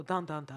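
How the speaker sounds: low, sung "dun dun dun" like a TV cliffhanger; mock a suspense jingle; dramatic suspense sting. Suspense sound after "something happened.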